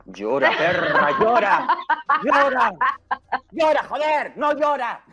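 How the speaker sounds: mock cruel taunt. Host imagining haters yelling at Alicia to cry, building mock shout. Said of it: "me imagino a esa gente en casa en plan".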